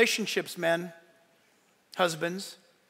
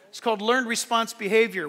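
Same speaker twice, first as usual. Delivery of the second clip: ordinary talk, nothing marked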